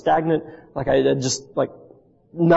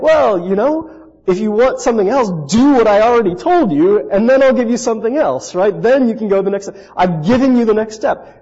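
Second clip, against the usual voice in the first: higher, louder, insistent retort; emphatic admonishing; 6 semitones higher, 5 dB louder than usual. Retold or imitated. God answering a stalled seminary student, emphatically admonishing. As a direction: lay it out firmly, stretching the opening. Voicing God's firm reply.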